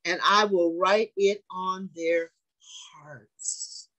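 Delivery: slow, weighty, drawn-out reading; preaching scripture. Acted. Preacher reading the covenant to his congregation, slow and weighty. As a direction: lay each word down slowly.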